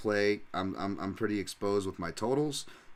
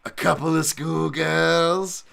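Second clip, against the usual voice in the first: slow, higher, louder British drawl; Michael Bisping; 8 semitones higher, 9 dB louder, much slower than usual. Retold or imitated. Streamer reads a note in the commentator's voice, slow British drawl. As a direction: stretch it out like the cheeky commentator.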